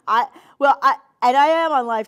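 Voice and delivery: loud laughing burst on live TV; laughing loudly. Real, not acted. Host laughing to viewers, loud and amused.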